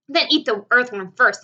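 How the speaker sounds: loud, selfish shout; loud cry. A narrator's reading. Centipede shouts that the earthworm be eaten first. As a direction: yell it out to save himself. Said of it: "Centipede cried".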